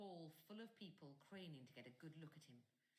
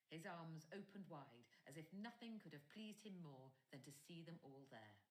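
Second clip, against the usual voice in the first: usual speaking voice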